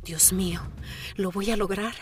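whispered in disbelief; awed whisper. Real, not acted. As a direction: breathe it out, moved. Susurro emocionado al entrar. (susurro emocionado)

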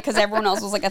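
usual speaking voice

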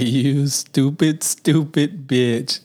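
slow, drawn-out cartoon voice; Roger impression. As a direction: stretch each word out mockingly. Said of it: "I forgot how I said it. I'm like".

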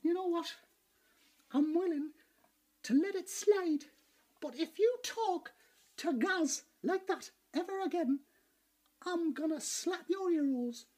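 slow, measured threat with pauses; slow menace. Acted. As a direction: lay down a warning, spacing out each phrase. Calm threat after the outburst.

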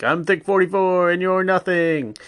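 high, cocky battle cry; Thick forty-four. Retold or imitated. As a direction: imitate a cocky gamer charging into battle. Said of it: "his character's kind of like a cocky douchebag".

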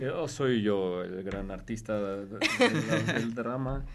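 lightly voiced, slow self-important star; mock arrogant star. Teasing a friend, mock arrogant star voice. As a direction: play the puffed-up great artist, slowly. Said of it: "ya te crees mucho".

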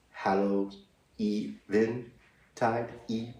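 Hallow Even tide pulled apart piece by piece; broken-down word. Shown as a demonstration. Explaining the name's origin, Hallow Even broken into parts. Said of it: "it's called Halloween because".

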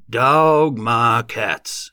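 loud, very drawn-out rustic amazement; dumbfounded farmer stretching every word; odd exclamation. A narrator's reading. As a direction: exclaim it slowly, thunderstruck. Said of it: "exclaimed in an odd voice".

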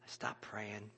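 whispered confession; hushed whisper. Real, not acted. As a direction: drop to a bare whisper. Retelling quitting prayer, hushed.

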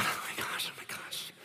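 hushed, voiceless whisper; mock emotional whisper. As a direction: whisper like a wedding guest trying not to cry. Imitating the moved wedding crowd.

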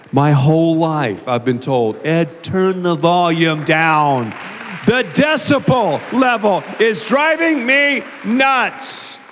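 slow, booming over-projection; loud talker. Shown as a demonstration. Guilty loud talker quoting complaints about his volume, booming. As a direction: boom out every word, drawn out. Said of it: "the loud talker".

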